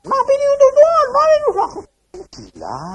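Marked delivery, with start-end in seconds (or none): 0.1-1.9 high, loud ecstatic cry; prisoner yelling. Retold or imitated. Prisoner shouting at the preaching doctor, loud high cry. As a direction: shout it like a man possessed in a cell. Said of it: "teriak-teriak terus".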